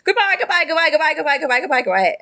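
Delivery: goodbyes tumbling out, starting high and sinking; very rushed. Acted. Phineas cutting off questions with hurried goodbyes. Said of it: "a very rushed".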